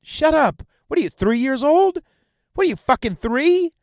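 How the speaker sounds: high-pitched indignant scolding; exasperated scolding. Real, not acted. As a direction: tell them off in exasperation. Scolding those who believe that version.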